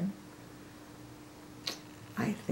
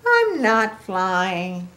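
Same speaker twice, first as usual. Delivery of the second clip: honest admission; 2 semitones higher, 8 dB louder, much slower than usual. A narrator's reading. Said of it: "Piggy wants to be truthful".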